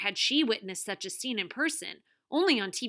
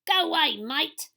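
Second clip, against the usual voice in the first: loud, higher fake Australian accent; bogus Australian accent; 7 semitones higher, 8 dB louder than usual. A narrator's reading. TV star shoos intruders away, bogus Australian accent. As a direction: put on a bad Steve Irwin Australian twang. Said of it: "The bogus Steve Irwin accent was unmistakable".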